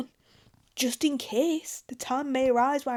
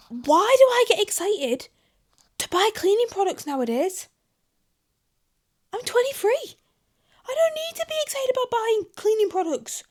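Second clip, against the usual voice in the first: excited; 11 semitones higher, 6 dB louder than usual. Real, not acted. Excited about cleaning products.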